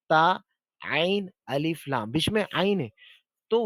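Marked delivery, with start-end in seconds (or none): 0.1-2.1 slow, separated letter names; spelling letter by letter. Shown as a demonstration. Teacher spelling the word ताल slowly. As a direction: spell out ता, आइन, अलिफ one by one. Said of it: "उसको अरबी में कहते हैं ताल".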